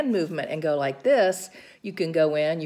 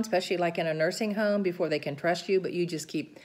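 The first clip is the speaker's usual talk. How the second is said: ordinary talk, nothing marked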